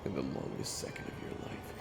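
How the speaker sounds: soft, near-whispered last moments; hushed narration. A narrator's reading. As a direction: tell it very softly, like final thoughts. Narrator, hero's last seconds.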